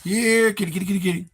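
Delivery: second cat call. Shown as a demonstration. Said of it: "I can't even do that right".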